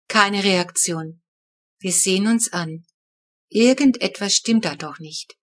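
ordinary delivery

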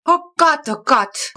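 high, loud gasping oh-God; mother crying out in horror at the pond; horrified cry. A narrator's reading. Mama in shock at the ruined garden pond, crying out horrified. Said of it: "ruft Mama entsetzt".

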